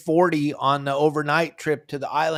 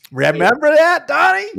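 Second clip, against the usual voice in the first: loud, much higher eager question; excited; 9 semitones higher, 5 dB louder than usual. Real, not acted. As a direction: burst out with fishing excitement. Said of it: "I get excited about fishing".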